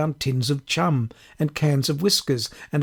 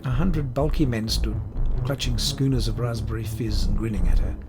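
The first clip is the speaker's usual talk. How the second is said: usual speaking voice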